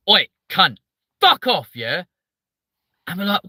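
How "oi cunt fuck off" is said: loud, higher southern English outburst; aggressive southern accent. Retold or imitated. Streamer showing how southerners swear, loud aggressive southern accent. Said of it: "down south, we go".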